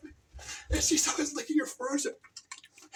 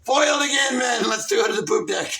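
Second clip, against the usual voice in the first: loud, booming order to the crew; big theatrical buccaneer shout; thwarted pirate captain; 2 semitones lower, 11 dB louder than usual. Acted. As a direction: bellow it to your men on deck. Pirate captain addresses his crew.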